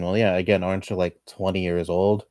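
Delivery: ordinary delivery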